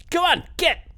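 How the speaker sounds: high, loud snarling shout. Acted. Woman driving the shark creatures off, high loud snarl. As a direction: bark it out to scare off the sharks. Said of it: "and snarls".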